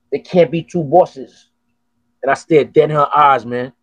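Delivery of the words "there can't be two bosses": very slow, deliberate delivery; slow firm statement. Retold or imitated. Telling the woman who's boss, very slow and deliberate. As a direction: lay down the law word by word. Staring her down in retold story.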